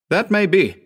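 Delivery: high, firm and resolute; determined. A narrator's reading. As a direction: stand firm against fear. Howard answers firmly.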